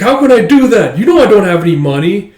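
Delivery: high, loud, aggrieved complaint; acted indignant whine; exasperated protest. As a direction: argue helplessly with your brother. Brother protests he is broke.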